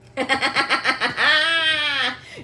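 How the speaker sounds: loud, high staccato cackle; long taunting laugh that climbs at the end; gloating cackle. Real, not acted. Woman gloating that husband was so scared, cackling.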